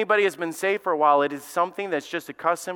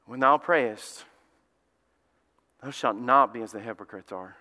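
solemn scripture reading; 8 semitones lower than usual. Shown as a demonstration. Preacher reading Jesus's words to the congregation, low and solemn. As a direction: intone the verse gravely. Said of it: "He said".

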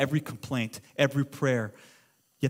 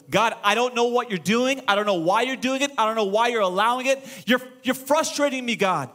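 acted exasperation, somewhat higher voice; mildly raised, frustrated pleading; frustrated complaint to God; 6 semitones higher than usual. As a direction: protest to God, fed up. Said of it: "Habakkuk is yelling at God".

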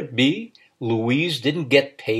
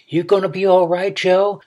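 calling out higher to be heard; repeated louder; 7 semitones higher, 5 dB louder than usual. A narrator's reading. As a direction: raise your voice so he hears you. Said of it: "she repeated louder".

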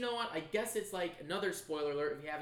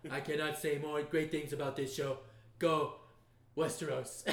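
Trump impression; 3 semitones lower than usual. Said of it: "Trump comes out".

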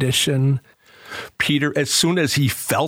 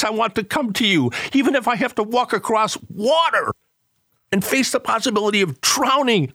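raised, pleading prayer voice, lifting as it goes; heartfelt prayer; 7 semitones higher than usual. Acted. Preacher voices the congregation's prayer to Jesus, pleading. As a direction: pray it up to the Lord with longing. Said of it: "that should be our prayer".

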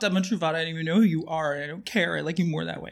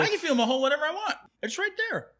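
high mock protest; 11 semitones higher, faster than usual. Joking about his hole.

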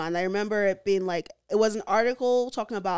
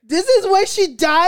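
loud, high shocked question; high-pitched, loud outburst of shock; shocked disbelief; 11 semitones higher, 12 dB louder than usual. Real, not acted. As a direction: blurt it out in stunned disbelief. Shocked reaction to the plot.

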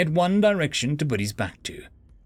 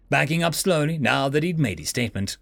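usual speaking voice

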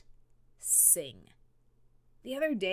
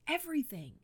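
"Everything" said alone, higher pitch, falling; emphatic echo; 7 semitones higher, 4 dB quieter, faster than usual. Shown as a demonstration. Host echoing the last word for emphasis, high and falling. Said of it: "pray about everything".

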